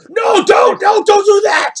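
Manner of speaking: very high, loud protesting yell; panicked orc. Acted. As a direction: cry out in alarm as a high-voiced orc. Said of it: "you hear behind him".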